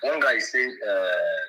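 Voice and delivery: faint caller over a speakerphone; low, distant voice on a phone line; phone speaker. Real, not acted. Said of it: "Just make it audible more".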